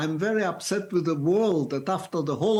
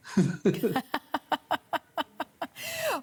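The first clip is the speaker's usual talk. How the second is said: amused laugh; 11 semitones higher than usual. Real, not acted. Old man amused by child's letter.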